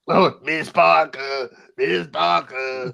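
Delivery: slow, hammy commentator impression; drawling oversold catchphrase imitation; Booker T. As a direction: stretch it out in a put-on voice. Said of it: "My fucking Booker T impression".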